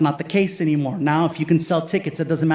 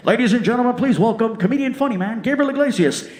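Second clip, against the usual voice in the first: announcer; 6 semitones higher than usual. Retold or imitated. Casino speaker introducing the comedian, big announcer read. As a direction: announce him like an MC. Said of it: "voiceover on the freaking speaker".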